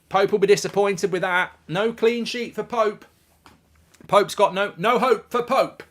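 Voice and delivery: high, loud commentator proclamation; big, ringing football-call delivery; punning commentary. Real, not acted. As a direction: announce it like a headline, stretching the ending. Calling out a punning commentary line.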